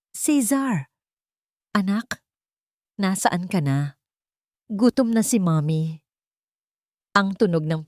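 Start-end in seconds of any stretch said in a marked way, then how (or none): 0.1-6.0 mild weeping mother. A narrator's reading. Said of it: "mahinang iyak ng kanyang ina".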